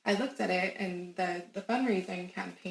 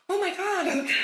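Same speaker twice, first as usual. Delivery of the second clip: high, louder exclamation; real amazement; 11 semitones higher, 5 dB louder, slower than usual. Real, not acted. Marvelling at her dog's guidance, amazed. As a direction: burst out overwhelmed with wonder.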